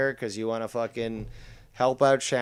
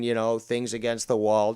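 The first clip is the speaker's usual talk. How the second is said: ordinary talk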